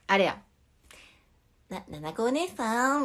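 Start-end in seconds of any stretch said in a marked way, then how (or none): 1.7-3.0 higher, slow cartoon character voice; Crayon Shin-chan. Shown as a demonstration. Streamer tries a character's line for viewers, Shin-chan voice. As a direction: draw out the name like a cartoon character. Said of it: "クレヨンしんちゃんってなんて言うっけな".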